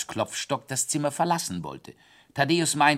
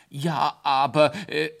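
frightened stammer; 6 semitones higher, 6 dB louder than usual. A narrator's reading. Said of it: "stotterte Klopfstock erschreckt".

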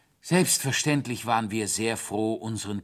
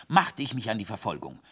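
ordinary delivery

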